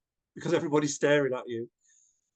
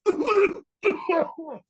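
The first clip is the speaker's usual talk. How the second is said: much higher, squeaky sheepish apology; apologetic audience member; 13 semitones higher, 5 dB louder, much slower than usual. Retold or imitated. Teacher imitates an audience member apologizing with a high sheepish voice. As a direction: mimic a coughing listener excusing themselves. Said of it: "others will be going".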